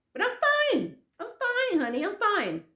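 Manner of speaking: very high-pitched imitation of mom; put-on high motherly reassurance; reassuring mother. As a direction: insist all is well in a high voice. Voices mother reassuring daughter.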